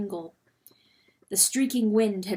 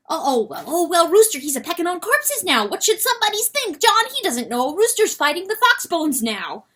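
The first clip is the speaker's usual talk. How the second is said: high, loud, fast-rising panicky weasel; frantic weasel John; 9 semitones higher, 6 dB louder than usual. Acted. Weasel John telling Lady Hen of the rooster, frantic and high. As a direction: stammer out the horror in a rush.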